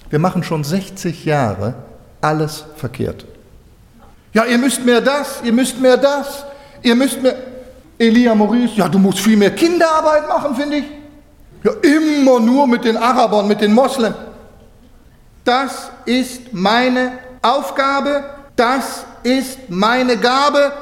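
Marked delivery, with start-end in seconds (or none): 4.3-14.1 nagging critics. Retold or imitated. Mocking critics of the mission. 15.4-20.7 high, very slow and deliberate; each word laid down like a vow; John's steady voice. Retold or imitated. John the Baptist speaking calmly.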